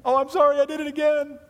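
hammed-up high whiny apology; squeaky mock apology. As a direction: squeal it like a caught kid. Voicing repeated apology.

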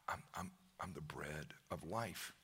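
soft, intimate, nearly breathed; hushed Jesus voice. Acted. Jesus speaking softly to the seeker, hushed. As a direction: speak it close, like a secret.

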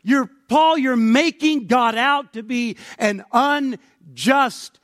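loud, high cry of protest; indignant objection. Acted. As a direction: object at full voice, stretching the key words. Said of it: "it rises up and says".

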